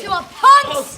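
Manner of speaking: high, very loud shouted insult; angry yell. Acted. Insulting the others in a brawl, angry yell. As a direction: hurl it at them in anger. Yelling insult amid a fight.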